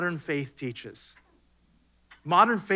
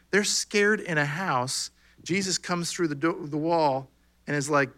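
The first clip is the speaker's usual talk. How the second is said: ordinary delivery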